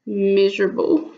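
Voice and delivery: usual speaking voice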